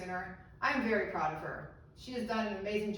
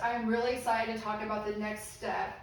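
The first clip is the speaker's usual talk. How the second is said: usual speaking voice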